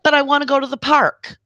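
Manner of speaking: mock child begging to go to the park; whiny kid. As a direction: whine it like a kid dodging blame. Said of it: "he says".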